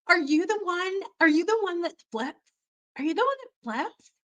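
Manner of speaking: put-on high, eager girlish asking; a schoolgirl eagerly quizzing the new girl; eager question. Girls at new school asking eagerly about flips.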